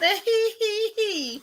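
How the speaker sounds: high-pitched giggling laugh; high giggle. Real, not acted. Laughing at the quoted line.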